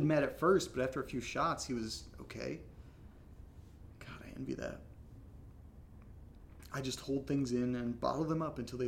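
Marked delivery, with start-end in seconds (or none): 4.0-4.8 soft whisper to himself; whispered aside. Real, not acted. Alone after his friend leaves, whispering to himself. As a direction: whisper it so no one hears.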